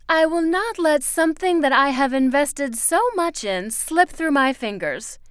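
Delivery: loud, high, forceful refusal; Miss Foster, vehement. Acted.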